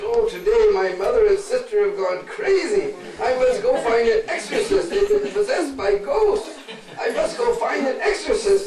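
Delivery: high, loud, alarmed cry; panicked son. Acted. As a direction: rush off in panic for help. Son panics, seeks exorcist.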